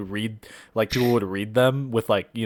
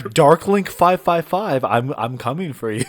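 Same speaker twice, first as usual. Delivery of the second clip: mock creepy voice; 5 semitones higher, 5 dB louder than usual.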